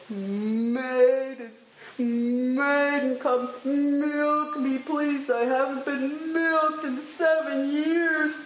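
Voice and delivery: uncomfortable cow. A narrator's reading. Cow begging a maiden to milk her, in a high drawn-out call. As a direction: draw out the words like an aching cow's call. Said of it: "that cow was uncomfortable".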